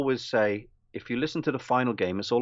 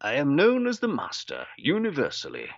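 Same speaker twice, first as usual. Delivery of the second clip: posh villain, pitched up; Roger Delgado; 4 semitones higher than usual. Acted. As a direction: announce yourself grandly as a posh villain. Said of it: "And he goes".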